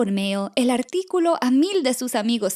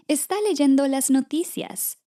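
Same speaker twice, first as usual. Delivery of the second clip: plain reading voice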